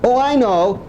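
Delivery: ordinary talk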